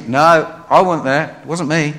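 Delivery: loud, hurried denial; panicked denial. Retold or imitated. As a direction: deny it loudly, pushing them off. Peter denies to bystanders.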